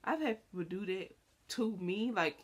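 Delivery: normal talking voice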